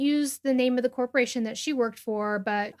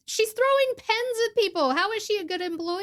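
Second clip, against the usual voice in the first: high, pressing outrage; real indignation at the dentist; indignant; 9 semitones higher than usual. Real, not acted. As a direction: make the absurdity obvious. Outraged at the boss's excuse.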